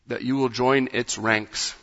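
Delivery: normal talking voice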